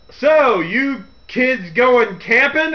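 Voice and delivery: loud, high, slow Southern drawl; thick Southern drawl. Retold or imitated. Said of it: "he has the thick Southern drawl".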